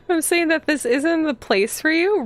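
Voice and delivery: high-pitched offended disbelief; indignant echo. Real, not acted. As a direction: repeat it back, incredulous and high. Mocks the character's insult back.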